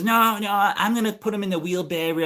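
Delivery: high mock protest; imitating old lady. Old lady refusing neighbor's plow help, mimicked high. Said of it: "she goes".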